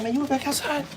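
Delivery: high sing-song voice for the dog; pet talk voice. Real, not acted. Owner talking to his dog Joker, high baby talk.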